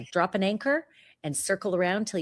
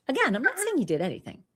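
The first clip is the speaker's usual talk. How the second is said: normal talking voice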